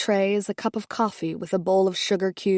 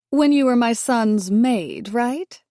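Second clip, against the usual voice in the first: "maid" leaned on, louder and slower; stress on maid; 4 semitones higher, 4 dB louder, slower than usual. A narrator's reading. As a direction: hit "maid" pointedly, slowly. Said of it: "emphasizing the word maid".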